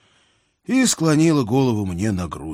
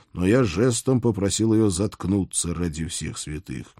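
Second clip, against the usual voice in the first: ordinary talk, nothing marked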